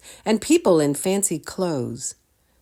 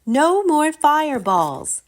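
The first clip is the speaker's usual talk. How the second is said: high, loud and slow scolding; yelling; 12 semitones higher, 6 dB louder than usual. A narrator's reading. Girl yelling at the dragon to stop. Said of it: "she yelled at the dragon".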